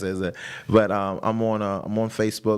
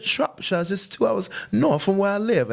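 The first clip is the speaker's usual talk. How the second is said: high-pitched imitation of his wife's British accent; 12 semitones higher than usual. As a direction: mimic a British woman explaining, voice well up. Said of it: "her accent".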